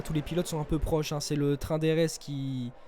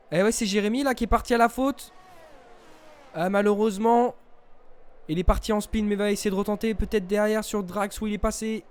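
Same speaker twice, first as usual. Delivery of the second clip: excited commentary; 5 semitones higher, 5 dB louder than usual. Real, not acted. Live sim racing commentary.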